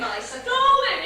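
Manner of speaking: "I stalled him" repeated, punching "stalled" up and loud; insistent. Acted.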